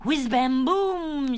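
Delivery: high, loud, long-drawn boom; shouted firework noises. Acted. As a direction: let it fly like a rocket, then explode. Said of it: "shouted Pudgy and Sal".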